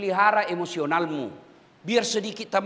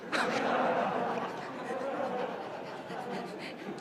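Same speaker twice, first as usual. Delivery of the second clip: soft laughter; 10 dB quieter than usual. Real, not acted. Audience joke punchline.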